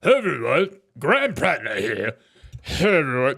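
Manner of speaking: impersonating the senator's video intro, hammed-up; mock manly voice. Streamer mocks the senator's greeting in a fake manly voice. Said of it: "This is my manly voice".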